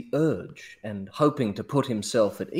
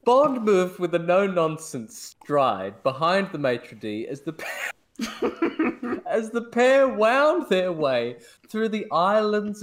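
reading through giggles; can't stop laughing mid-sentence; 6 semitones higher than usual. Real, not acted. Reread while laughing.

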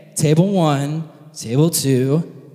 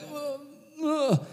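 high, soft drawn-out whoa; acted wonder, hushed and high; mock awe; 9 semitones higher, 8 dB quieter than usual. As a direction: coo in exaggerated amazement. Brothers awed on arrival.

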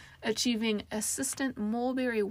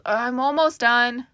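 loud, drawn-out call with raised pitch; yelling; 4 semitones higher, 11 dB louder, slower than usual. A narrator's reading. Casey interrupted in the bath by knocks, yelling. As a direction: yell it through the door. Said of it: "she yelled".

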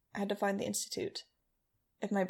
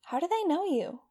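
put-on kid voice; 10 semitones higher, 4 dB louder than usual. Young Gasman asks, alarmed.

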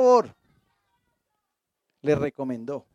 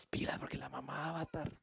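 put-on whispered warning to the kids; joking whisper; 4 semitones lower, 21 dB quieter than usual. Husband jokingly whispers to his kids about his wife, conspiratorial whisper. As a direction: whisper it so the wife won't hear.

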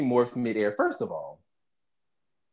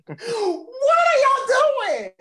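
high, slow, crying-out mock yell; exasperated viewer shouting at characters who jump off cliffs; 3 dB louder, slower than usual. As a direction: holler at the screen in disbelief. Speaker yells at the characters.

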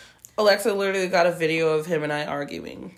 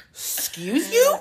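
high, slow offended retort; much higher, slow sassy comeback; sassy excuse you; 11 semitones higher, slower than usual. Retold or imitated. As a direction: clap back with mock outrage. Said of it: "And you're like".

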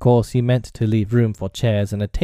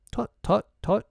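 high, fussy tutting; pretend doctor; 11 semitones higher than usual. A narrator's reading. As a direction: tut importantly as a make-believe doctor. Slightly plays doctor.

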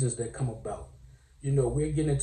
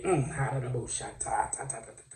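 ordinary delivery

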